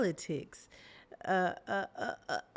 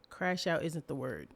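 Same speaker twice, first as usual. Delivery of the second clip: usual speaking voice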